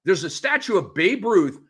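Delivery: normal talking voice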